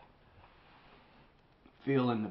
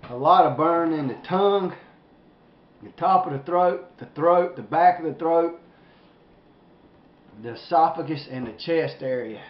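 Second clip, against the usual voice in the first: louder, higher, pained from heat; strained loud from burn; 4 semitones higher, 6 dB louder than usual. Real, not acted. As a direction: push it out through the burn. Burning from pepper, building.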